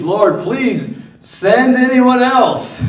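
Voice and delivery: high, slow, half-laughing mock plea; hammed-up desperate plea with a laugh in it; pleading. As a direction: beg like a whiny Moses, tongue in cheek. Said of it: "And he pleads".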